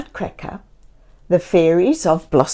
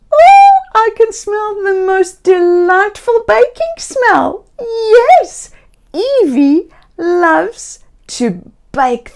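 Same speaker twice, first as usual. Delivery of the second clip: much higher, louder, slowed delight at the smell; sing-song childlike delight, stretched out slowly; 11 semitones higher, 7 dB louder, slower than usual. A narrator's reading. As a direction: gasp in wonder at the baking smell. Narrator delights in Evie's baking.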